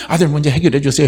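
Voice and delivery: ordinary talk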